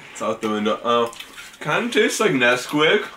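chewing while talking; speaking between spoonfuls; mouth full of cereal. Real, not acted. Seen 0: Both hold bowls and take spoonfuls; chewing mouths throughout; speaker unclear.

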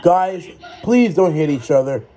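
normal talking voice

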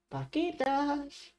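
high, drawn-out singsong call; long, high, soft animal call; calling for cows. Pretend. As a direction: coax them out in a slow singsong.

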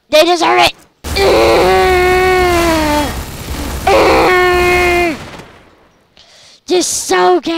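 loud angry yelling; loud outraged yell. Real, not acted. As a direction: shout it at the screen. Yelling at the characters.

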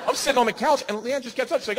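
usual speaking voice

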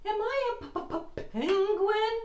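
high, slow, sing-song stammer; chattering teeth on p; shivering stutter. Acted. Shivering penguin asks whale.

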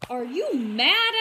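loud, slow, outraged question; shocked grocer. Acted. The grocer scolding young Herbert, loud and slow. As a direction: challenge him in disbelief.

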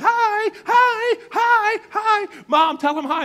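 put-on very high, loud little-kid squeal; mock screaming. Young daughter screaming hi from the back seat, mock scream. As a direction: imitate an overexcited child yelling for attention.

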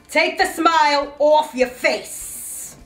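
loud, high, slow shout; angry order. Acted. Boss ordering employee to stop smiling, shouted. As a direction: shout each word as a command.

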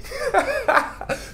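high, light burst of laughter; amused giggle at the slang word just said; real laugh. Real, not acted. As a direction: break into a short high laugh. Laughs after teaching slang word saucé.

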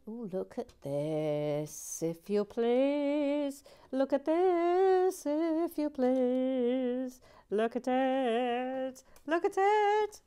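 high, very slow "look at this, if you please"; mock grand voice. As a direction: present it like a pompous showman. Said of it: "showing off a figure, mock voice".

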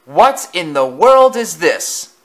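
a proud engine startled by something strange. A narrator's reading. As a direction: stop short in shock.

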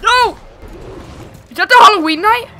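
loud, high panic in a game; alarmed shouting. Real, not acted. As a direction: yell a warning as you're chased. Enemy chases the players.

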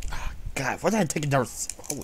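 ordinary talk, nothing marked

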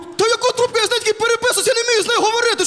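high-pitched, panicky objection; flustered protest. Acted. Panics at being told to pick up.

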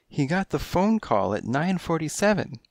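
high, coy feline voice; high kittenish client voice; coy cat lady. A narrator's reading. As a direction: purr it like a kittenish femme fatale.

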